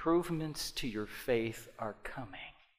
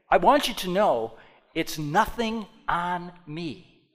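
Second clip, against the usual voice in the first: ordinary delivery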